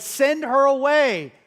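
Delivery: high, loud, stretched-out order; acted demand. Preacher quoting disciples against the woman, loud demand. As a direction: call it out slowly and forcefully.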